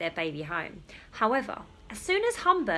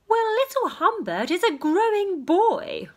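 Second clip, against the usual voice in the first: much higher doting mother; 11 semitones higher, 4 dB louder than usual. Acted. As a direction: defend your baby sweetly. Mother defends baby.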